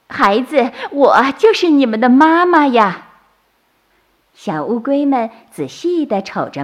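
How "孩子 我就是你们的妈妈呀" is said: high, loud, overjoyed voice; joyful mother. A narrator's reading. Turtle mother finds her babies, overjoyed. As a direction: call out happily to your children. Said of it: "她高兴地说".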